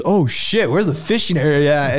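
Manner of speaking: a loud, high, eager exclamation; excited. Retold or imitated. Star hearing about fishing, loud eager excitement.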